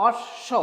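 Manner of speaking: "অশ্ব" split on a long hissed sh, b left silent. Shown as a demonstration. Teacher showing learners the silent ব, অশ্ব said as "osh-sho". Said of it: "বয়ের উচ্চারণ আসছে না".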